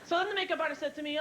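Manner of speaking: normal talking voice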